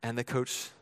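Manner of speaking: ordinary talk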